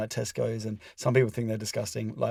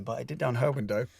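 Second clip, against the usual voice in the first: normal talking voice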